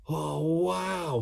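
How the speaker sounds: high wow of wonder; amazed. A narrator's reading. Ned floating through the air, high and amazed. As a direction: marvel at flying. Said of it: "he exclaimed".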